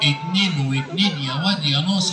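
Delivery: very low-pitched puppet character; Aragoz puppet. Acted. Puppet explaining his visit to the host, Aragoz voice. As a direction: play a comic puppet, far below your own pitch. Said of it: "الأراجوز ده وهو بيرغي".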